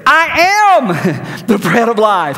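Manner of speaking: mock exasperated Jesus; exasperated inflection. Shown as a demonstration. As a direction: say it like they haven't heard a thing. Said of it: "I just read that with that kind of inflection".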